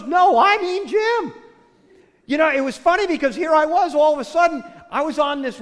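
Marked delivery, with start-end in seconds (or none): 0.0-1.3 louder, slower, higher, hammered no, I mean Jim; emphatic repeat. Retold or imitated. Woman repeating herself to doubters, loud and slow. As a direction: she digs in, each word slow and loud. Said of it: "She goes".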